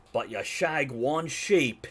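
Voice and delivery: slow, loud, broad Scottish; Scottish accent. Shown as a demonstration. Game master saying his key phrase to find the Scottish accent. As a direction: lean hard into a drawn-out Scottish accent. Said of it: "key word I used to get into Scottish accent".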